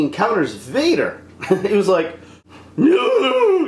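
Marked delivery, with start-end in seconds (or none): 2.8-3.7 high, drawn-out mock cry; hammed-up enraged howl; imitated rage. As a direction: wail it like a melodramatic movie hero. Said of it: "angry, all this, like, rage inside of him".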